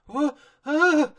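high, loud startled yelp; startled cry. Retold or imitated. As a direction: yelp as the house lurches. Ponies in moving house.

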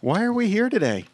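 high, bright playful sing-song. Real, not acted.

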